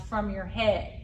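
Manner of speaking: usual speaking voice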